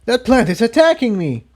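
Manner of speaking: understated panicked shout; shouting back. Acted. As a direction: cry out under attack. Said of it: "Incendia shouted back".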